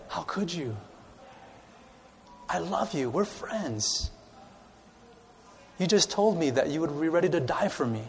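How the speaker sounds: soft, tender heartbroken plea; tender hurt. Acted. Jesus speaking to Peter, soft and tender. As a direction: speak softly, hurt but loving. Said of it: "it wasn't a look of".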